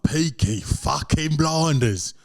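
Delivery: put-on slow Brummie accent, lightly done; Birmingham accent. Comic at soccer gig, slow Birmingham accent. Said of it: "And I was like".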